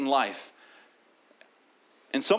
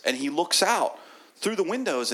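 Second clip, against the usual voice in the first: ordinary delivery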